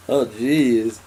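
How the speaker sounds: ordinary talk, nothing marked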